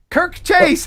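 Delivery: excited name call. Real, not acted. Host spotting a new member in chat, delighted call. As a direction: spot him and call it out happily.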